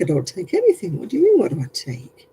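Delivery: usual speaking voice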